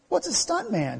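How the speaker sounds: put-on six-year-old son asking. Young son to his actor dad, puzzled child. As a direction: ask it like a curious little boy. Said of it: "he asked".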